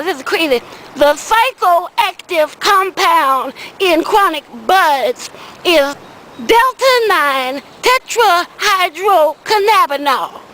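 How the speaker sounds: ordinary talk, nothing marked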